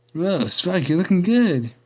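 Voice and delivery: high-pitched mock admiration; teasing, holding back laughter. A narrator's reading. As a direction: tease him with rising amusement. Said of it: "trying her best to crack up with laughter".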